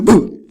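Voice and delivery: loud high boo to startle; startling boo. Pretend. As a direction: shout boo to make them jump. Jump-scare.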